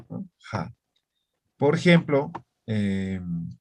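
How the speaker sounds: ordinary delivery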